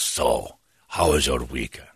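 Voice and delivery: low, smooth attendant; beautiful speaking voice. Retold or imitated. Attendant chatting while teller washes up, beautifully spoken. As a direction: imitate a deep, suave host. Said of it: "He's got a beautiful speaking voice".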